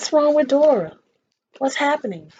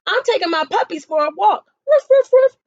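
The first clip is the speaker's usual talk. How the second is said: higher, louder doggy voice with woofs; a busy dog barking its excuse; 9 semitones higher, 6 dB louder than usual. A narrator's reading. Dog talks between barks.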